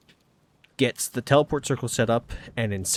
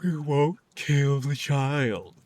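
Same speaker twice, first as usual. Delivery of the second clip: grudging sigh; much slower than usual. Retold or imitated.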